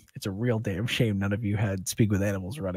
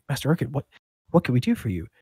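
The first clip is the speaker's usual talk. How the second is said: higher, eager servant's question; eager deference; 6 semitones higher, 6 dB louder, much faster than usual. Acted. Kneeling couple offering service to their master, eager deference. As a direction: offer help, flustered and raised in pitch.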